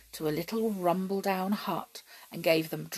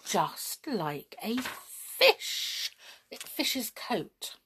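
usual speaking voice

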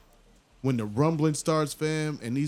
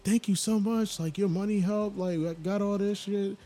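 put-on high, light kid voice; lightly voiced grateful kid; grateful little boy; 9 semitones higher than usual. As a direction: thank him like an eager child. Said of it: "he's like".

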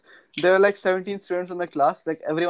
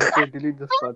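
usual speaking voice

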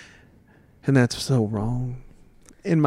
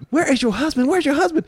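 much higher, frantic searching; panicked producer; 12 semitones higher than usual. Retold or imitated. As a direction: voice them hunting for the husband. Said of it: "So they were like".

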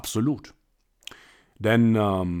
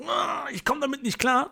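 much higher mock whine of a struggling seller; whiny complainer; 14 semitones higher than usual. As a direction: play the overwhelmed rep who can't cope. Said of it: "wo du sagst". (gespielter Jammerer, viel höher)

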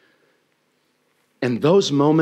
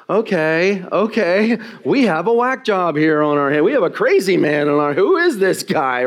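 higher, louder mock alarm; alarmed crowd reaction; 7 semitones higher, 4 dB louder than usual. Preacher acting out crowd doubting Jesus, mock alarm. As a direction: play the crowd backing away from a madman.

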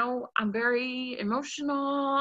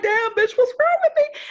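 much higher, louder mock cry of despair; hammed-up frustrated outburst; loud exasperated self-doubt; 8 dB louder than usual. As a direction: wail at yourself after a rejection. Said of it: "and you be like".

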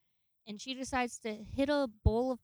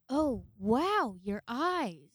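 mock amazement; 2 semitones higher, much slower than usual. Acting out astonished cops at eyes.

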